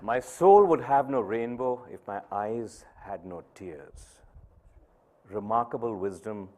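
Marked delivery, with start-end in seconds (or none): 0.0-4.2 slow and weighty, louder on "soul"; slow solemn quote. Acted. Speaker echoing daughter's words to audience, slow and weighty. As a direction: let each word land slowly.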